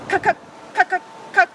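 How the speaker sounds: high clipped cuck calls; playful woodpecker imitation. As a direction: peck out each cuck like a woodpecker. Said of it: "Woodpecker calls from a tree".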